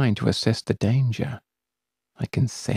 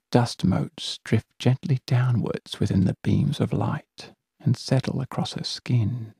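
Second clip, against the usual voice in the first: ordinary delivery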